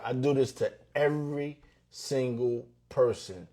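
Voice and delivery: ordinary talk, nothing marked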